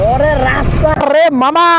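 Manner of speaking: slow, loud, drawn-out cry of delight at the road; excited yell. Real, not acted. Rider shouting to his friend about the smooth road, excited and loud. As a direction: belt it out to your buddy, thrilled. Night ride on empty road.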